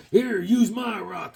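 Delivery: high, slow, drawn-out offer; cartoon character voice. Drawn guy offering his rock, high cartoon voice.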